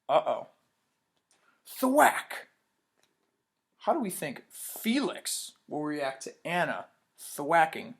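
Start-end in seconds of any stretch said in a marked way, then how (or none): 1.7-2.5 high, loud thwack; storyteller's loud thwack; loud smack sound. Acted. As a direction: make the crash land hard. Sister knocks the tower down.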